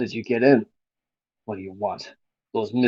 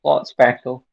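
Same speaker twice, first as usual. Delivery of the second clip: loud amused echo; 8 dB louder than usual. Real, not acted. Repeating the joke loudly.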